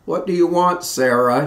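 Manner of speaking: a hint of a Southern drawl, calling back; lightly voiced Southern drawl. A narrator's reading. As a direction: answer lazily in a Southern drawl. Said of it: "in his slow southern drawl".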